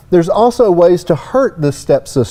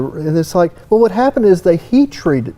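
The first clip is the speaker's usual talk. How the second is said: ordinary delivery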